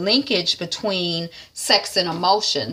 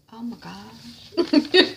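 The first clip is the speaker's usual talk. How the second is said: laughing; 7 semitones higher, much slower than usual. Real, not acted. Distracted, laughs at backyard.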